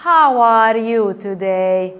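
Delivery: slow, unhurried hello; calm, slow good morning; slow greeting. Shown as a demonstration. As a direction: greet a slow talker at their pace. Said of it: "greet them accordingly".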